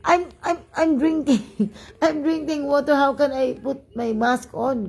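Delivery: high-pitched, stammered mock protest; imitated protest. Passenger answering the driver while drinking water, imitated protest. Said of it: "Ganun, ganun siya, gang".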